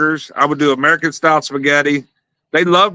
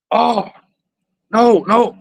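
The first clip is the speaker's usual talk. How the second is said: mock alarm; 8 semitones higher, slower than usual. Teammate realises it's medicine.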